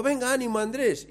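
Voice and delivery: cheerful encouragement. Retold or imitated. A fresh friend passes Andrés and cheers him on, high and breezy. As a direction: pass him fresh and chipper. Older runner cheering while passing.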